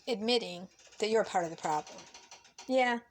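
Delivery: usual speaking voice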